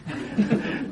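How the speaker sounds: soft, breathy little laugh; short chuckle. Real, not acted. Speaker amused by people fleeing a cockroach, short chuckle. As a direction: chuckle quietly mid-story.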